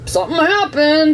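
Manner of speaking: a light mocking yes; mock agreeing. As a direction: toss it off lightly. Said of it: "and I was all like".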